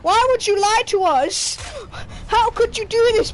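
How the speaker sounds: hammed-up outrage at his brother; imitating dad yelling. Dad yelling at his brother over the DNA test, mock furious. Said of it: "My dad is yelling at his brother".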